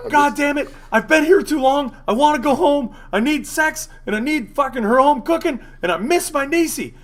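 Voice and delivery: loud, high mock tantrum; hammed-up meltdown, shouted way up high; high-pitched screaming. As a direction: imitate a friend screaming his frustration. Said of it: "And then screams, like, in a high-pitched".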